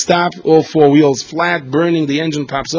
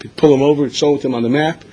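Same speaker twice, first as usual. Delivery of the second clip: ordinary talk, nothing marked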